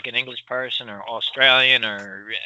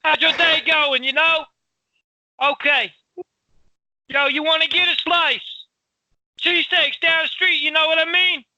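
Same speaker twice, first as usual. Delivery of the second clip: screaming across the street; 13 semitones higher than usual. Acted. Showing friend how Philly sounds, screaming across the street. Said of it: "they're screaming across the street".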